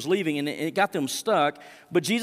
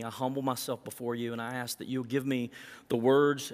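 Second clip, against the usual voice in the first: softer, lower, reverent prayer voice; 3 semitones lower, 4 dB quieter than usual. Acted. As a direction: pray quietly and humbly before God. Leads the congregation in prayer.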